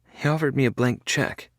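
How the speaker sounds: put-on a frail girl refusing surgery; voiced young woman. A narrator's reading.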